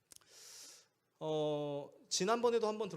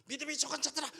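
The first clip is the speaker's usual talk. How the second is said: higher-pitched, sharp mock-angry scolding; angry rebuke; 9 semitones higher, faster than usual. Preacher imagining Jesus angrily rebuking those of little faith, angry. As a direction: scold the followers in a burst of acted anger. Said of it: "화가 나서 말씀하셨다".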